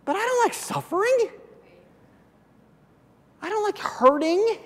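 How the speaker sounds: high, whiny mock complainer; a hammed-up whiny voice; whiny complaint. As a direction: whine it like a reluctant believer. Preacher voicing complaint, high.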